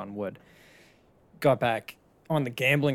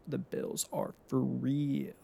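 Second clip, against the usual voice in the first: slow, softer echo of the claim; softer repeat; 6 dB quieter, much slower than usual. Real, not acted. Fan repeating the Bills verdict to himself, slow and softer. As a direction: let the verdict settle quietly.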